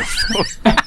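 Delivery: ordinary delivery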